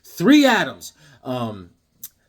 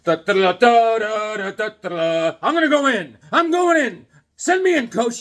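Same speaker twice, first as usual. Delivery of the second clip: da-da fanfare then higher, louder hero eager to go; hammed-up Superman; 5 semitones higher, 5 dB louder than usual. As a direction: sing the fanfare, then beg to be sent in. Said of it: "Superman's like".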